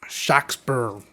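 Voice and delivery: "Shakespeare" said as SHOCK-sper, open "ah" and r-coloured end; original pronunciation. Shown as a demonstration. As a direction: say "Shakespeare" the old way, "Shahk-sper". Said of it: "is potentially how it was said".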